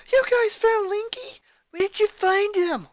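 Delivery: high, loud, tearful excitement; eager high squeal. Acted. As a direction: burst in desperate for news. Sister bursts in about Linky.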